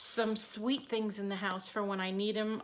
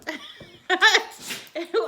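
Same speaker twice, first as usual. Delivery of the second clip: loud laugh; 9 semitones higher, 11 dB louder than usual. Real, not acted. Dog antics.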